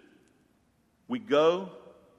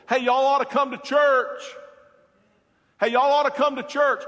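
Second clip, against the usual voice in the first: loud, high hammed-up calling out; brash mock church invite; mock invitation; 11 semitones higher, 9 dB louder than usual. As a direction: call out to the lost like a cheerful greeter. Mock invitation to the lost world.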